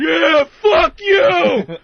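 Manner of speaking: screaming it high and loud; bellowing it at the clown; angry yell. Retold or imitated. Crowd yelling back at the dunk tank clown, a loud high yell. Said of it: "they would all start giving the finger".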